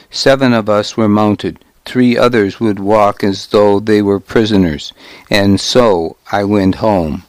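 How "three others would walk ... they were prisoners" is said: ordinary delivery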